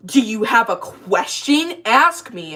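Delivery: slow, imitated challenge; confrontational shop guy. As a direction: mimic a rude man demanding an answer. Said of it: "the guy who harassed us was like".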